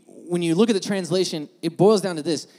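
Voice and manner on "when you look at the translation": ordinary delivery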